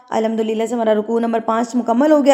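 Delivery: ordinary talk, nothing marked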